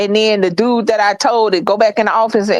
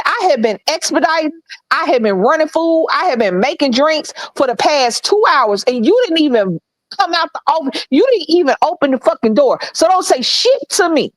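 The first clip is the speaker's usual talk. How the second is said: higher, punchy list of grievances; retold indignant tirade; 5 semitones higher than usual. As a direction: stack up grievances, building to a warning. Retold telling-off of manager.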